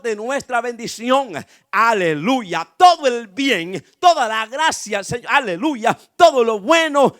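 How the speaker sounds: emphatic preaching, swelling to loud bursts; full-force preaching. Real, not acted. Preacher urging church to heed the blessing's voice, fervent preaching.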